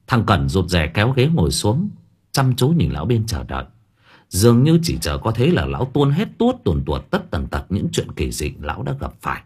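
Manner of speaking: ordinary delivery, nothing marked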